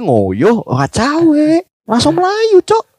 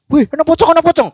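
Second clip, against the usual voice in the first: high-pitched mock alarm cry; retold yelling; 11 semitones higher, faster than usual. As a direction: act out hollering that a ghost is there. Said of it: "bengak-bengok". (niru bengak-bengok weruh pocong)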